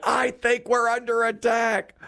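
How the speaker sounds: high, slow, insistent mock panic; mock alarmed wife. Wife insisting to unconcerned husband, imitated high alarm.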